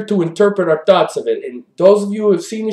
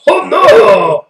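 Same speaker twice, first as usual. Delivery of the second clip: mock dismay; 3 semitones higher, 6 dB louder, slower than usual. Co-host caught forgetting his accent, loud mock dismay. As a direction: cry out in hammed-up dismay.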